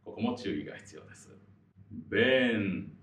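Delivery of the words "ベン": ベン loud, with long vowel closing on N; held final N. Shown as a demonstration. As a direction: stretch ベン and end on a clear N. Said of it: "ベーンというふうに終わる". (「ベン」を長く伸ばしてNで終える)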